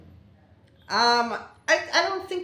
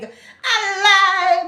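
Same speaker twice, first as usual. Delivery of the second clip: very high, very loud shocked cry; big disbelieving cry; 12 semitones higher, 12 dB louder than usual. Retold or imitated. Reacting to an incident again, loud shocked cry. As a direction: belt out "A lie!" in total disbelief. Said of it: "I had to give out a big".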